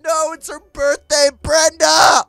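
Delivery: high, loud panicked shouting; hammed-up horror at a forgotten date. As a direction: yell it out in mounting panic. Remembers a forgotten birthday.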